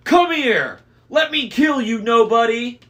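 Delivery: very high, loud raging bellow; furious howl. A narrator's reading. Cyclops howls at Annabeth in fury. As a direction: howl for blood like a maddened giant. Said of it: "Polyphemus howled".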